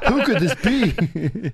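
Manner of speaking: slow, high, laughing through it; drawn out with a laugh; laughing mock mystery.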